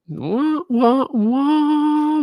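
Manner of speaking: high wah wah wah like a sad trombone; mock sad trombone. Mocking the slow speed test with a high sad trombone.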